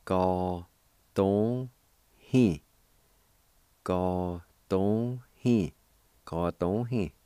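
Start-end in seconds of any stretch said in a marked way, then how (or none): 0.1-2.6 "Ka, ton, hin" spoken as separate syllables, "hin" louder; teacher sounds out the word for students; syllable drill. Shown as a demonstration. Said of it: "It's pronounced like this". 3.8-5.7 "Ga don hin" drawn out slowly, each syllable held long; slow model of "Ga don hin" for students; very slow demo. Shown as a demonstration. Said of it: "It's pronounced like this".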